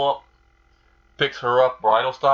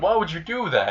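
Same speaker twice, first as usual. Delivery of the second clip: high-pitched indignant question; pissed off; 6 semitones higher than usual. Acted. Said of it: "like, really pissed".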